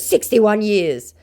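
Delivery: slow, high mock scammer. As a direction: state it as the scammer, slowly. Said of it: "he goes".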